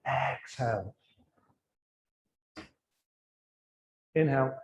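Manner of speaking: low, soft cues with long silences between; guided breathing meditation. Real, not acted. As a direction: calmly guide each breath, letting the words fade. Leads a breathing exercise.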